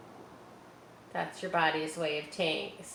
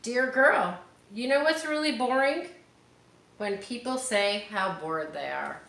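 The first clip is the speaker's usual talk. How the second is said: put-on reading to children; 5 semitones higher, 6 dB louder than usual. Teacher reading her favourite page, loud and bright. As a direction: belt out the page like a favourite story. Reads picture book aloud.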